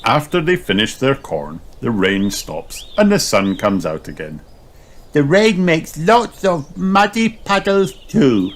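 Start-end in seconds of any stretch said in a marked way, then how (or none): 5.1-8.5 high slow piglet voice; delighted little girl; Peppa. A narrator's reading. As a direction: Peppa loving the puddles. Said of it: "says Peppa".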